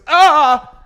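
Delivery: loud high shocked scream; girl in the ice cream line screams; startled scream. Retold or imitated. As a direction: shriek as if grabbed by surprise. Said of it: "the girl just gets up and goes".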